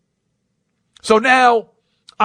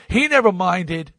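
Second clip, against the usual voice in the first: usual speaking voice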